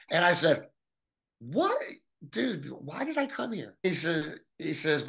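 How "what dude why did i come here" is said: high-pitched, put-out retort; retold disbelief. Insulted visitor to blunt acquaintance, in disbelief. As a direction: relive being taken aback, pitch jumping up. Acts own reaction to insult.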